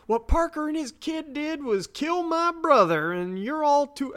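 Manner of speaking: high-pitched, indignant Southern woman; angry Southern drawl. Retold or imitated. Retelling a furious woman at the pool table, angry Southern drawl. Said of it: "why am I making her Southern?".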